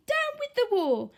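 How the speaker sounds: high hooting cry; a mild high hoot. A narrator's reading. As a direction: hoot it out gently. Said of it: "And he hooted".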